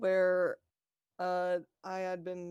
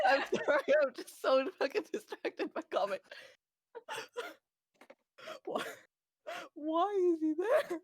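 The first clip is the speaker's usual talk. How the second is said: high, giggly words through laughter; laughing; 11 semitones higher than usual. Real, not acted. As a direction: crack up while talking. Cracking up at the bird.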